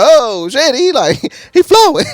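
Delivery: high, loud, laughing hype; amazed and laughing at the flow; hyped exclaim. Real, not acted. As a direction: shout out how good he is. Hyped by the rapping singer.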